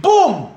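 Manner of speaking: high, loud boom; a sudden imitated boom; mock explosion. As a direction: burst out with an explosion noise. Imitating incense blast.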